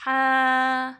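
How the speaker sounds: loud throaty ہا from mid-throat; demonstrated ha. Shown as a demonstration. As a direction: push ہا from the middle of the throat. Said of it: "یعنی کہ".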